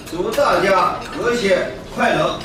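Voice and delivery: ordinary talk, nothing marked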